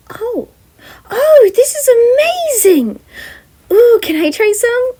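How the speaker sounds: very high, thrilled gush; excited Catherine. Acted. Catherine begging for cake, very high and thrilled. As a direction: squeal over the amazing cake.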